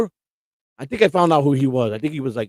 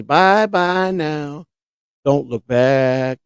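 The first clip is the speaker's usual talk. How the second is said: slow, drawn-out put-on voice of the AI woman; Miss Kitty voice; 3 dB louder, much slower than usual. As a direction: stretch each word like a cooing AI character. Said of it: "Miss Kitty says".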